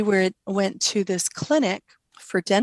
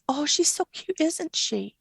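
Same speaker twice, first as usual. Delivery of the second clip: quoting her daughter; 6 semitones higher than usual. Retold or imitated. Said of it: "[name] says".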